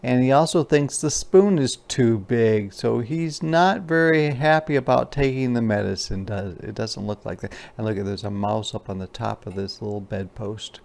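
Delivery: usual speaking voice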